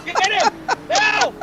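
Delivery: high-pitched, urgent egging on; excited cheering. Real, not acted. Urging driver in race.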